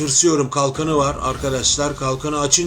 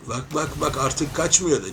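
ordinary delivery

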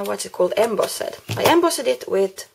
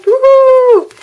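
high, loud joyful woohoo; cheer; 14 dB louder than usual. Real, not acted. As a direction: whoop it like a party. Celebrating sign-off.

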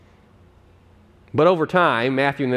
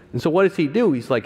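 normal talking voice

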